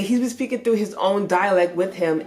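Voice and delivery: usual speaking voice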